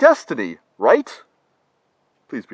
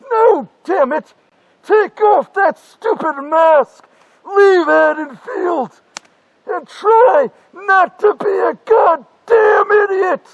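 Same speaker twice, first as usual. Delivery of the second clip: higher, louder, slow angry yelling; furious shout; 8 semitones higher, 6 dB louder than usual. Acted. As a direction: scream it at your past self. Future self yelling at himself.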